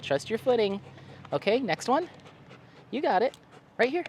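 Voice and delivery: high, upbeat encouragement to a pet; coaxing dog talk. Real, not acted. Owner coaxing the dog up the steps, high and encouraging. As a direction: cheer the dog on, voice lifted.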